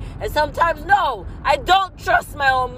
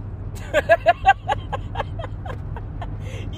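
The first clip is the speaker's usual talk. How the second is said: high cackle; 11 semitones higher than usual. Real, not acted. Cackling at own joke.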